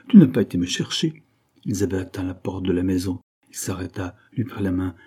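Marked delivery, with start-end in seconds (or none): 0.1-1.2 raised, louder alarmed cry; fearful exclamation. A narrator's reading. Anna fears William came looking for her, alarmed exclamation. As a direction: burst out with a touch of dread. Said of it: "saisie de crainte".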